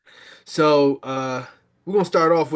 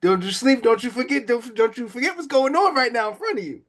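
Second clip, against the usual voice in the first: excited call-out; 9 semitones higher, 5 dB louder than usual. Real, not acted. Calling out to a player.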